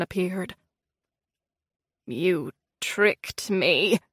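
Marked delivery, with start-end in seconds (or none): 2.1-4.0 low, slow and drawn out; slow low accusation; accusing queen. A narrator's reading. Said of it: "she said".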